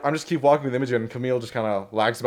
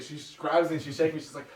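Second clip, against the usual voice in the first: ordinary delivery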